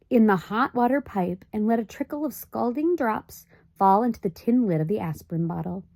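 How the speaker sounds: ordinary delivery, nothing marked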